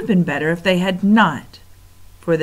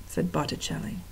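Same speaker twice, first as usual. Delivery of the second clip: usual speaking voice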